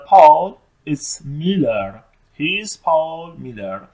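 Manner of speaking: very slow, deliberate English line; slow dialogue reading. Shown as a demonstration. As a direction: read each word slowly. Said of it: "بین شما و a friend". (جملهٔ انگلیسی خیلی آهسته)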